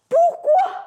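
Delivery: high, loud outburst of "why?"; mock bafflement. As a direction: squeal it in playful disbelief. Voices a baffled student's question. (stupéfaction feinte)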